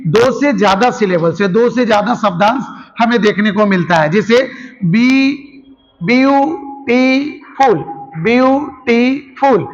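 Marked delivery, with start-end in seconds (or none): 4.8-9.7 "ब्यूटीफुल" very slowly in three parts, byu, tee, ful; syllable by syllable. Shown as a demonstration. Teacher showing a longer word to students, slow syllable-by-syllable "ब्यूटीफुल". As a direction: stretch and separate each syllable of "ब्यूटीफुल". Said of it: "शब्द को तोड़ तोड़ के बोला जाता है".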